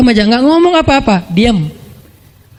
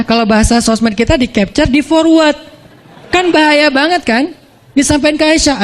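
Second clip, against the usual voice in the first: ordinary talk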